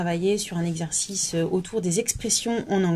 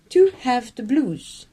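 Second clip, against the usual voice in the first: a touch of the British accent on the English part; 4 semitones higher, 3 dB louder, slower than usual. Shown as a demonstration. Teacher summarizing for members, light British accent. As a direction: finish with a lightly posh English phrase. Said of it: "parler british".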